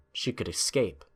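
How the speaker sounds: ordinary talk, nothing marked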